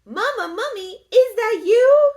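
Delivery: a tiny mummy calling for mama; little baby mummy. Acted. Baby mummy calls into the woods, high and loud.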